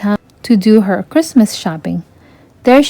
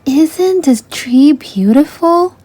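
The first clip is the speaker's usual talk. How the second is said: put-on higher, slow girlish voice full of wonder; admiring Ariel; 6 semitones higher, slower than usual. A narrator's reading. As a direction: gaze at the tree and marvel slowly. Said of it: "Ariel remarked".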